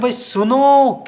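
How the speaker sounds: सुनो said slowly, final o held long; stretched call. Shown as a demonstration. Teacher demonstrating a pluta vowel, calling out long. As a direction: drag out the नो in सुनो. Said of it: "खींचते हो".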